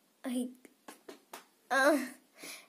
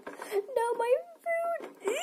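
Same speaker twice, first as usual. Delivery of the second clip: high, wailing cartoon pet cry; pet in character; 14 semitones higher, much slower than usual. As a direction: cry out like a little pet losing its dinner. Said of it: "I was getting in character".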